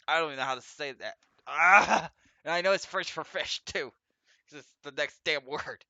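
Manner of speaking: loud, high, fast exasperation; frustrated outburst. Real, not acted. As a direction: yell at the word you can't say. Frustrated at French word.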